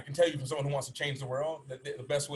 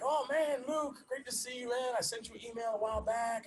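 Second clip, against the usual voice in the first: much higher, bubbly voice for an eager fan; excited stranger; 10 semitones higher than usual. Retold or imitated. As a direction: play the fan who spots him on the street. Quoting excited stranger on street.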